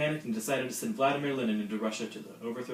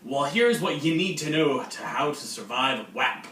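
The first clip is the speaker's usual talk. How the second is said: cheery senior; 6 dB louder than usual. Acted. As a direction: greet the freshman like an overeager host. Skit senior character continues.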